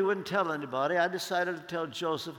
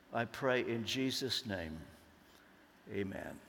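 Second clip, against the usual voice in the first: prayer; 4 semitones lower than usual. Real, not acted. Closing prayer before the sermon.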